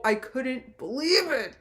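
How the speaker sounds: ordinary delivery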